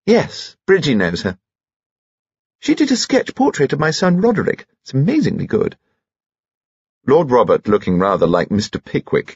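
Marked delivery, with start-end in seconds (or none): 0.1-5.8 effusive society lady. Acted. Mother praises the portrait of her son, effusive. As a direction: chat proudly in a lilting grande dame voice.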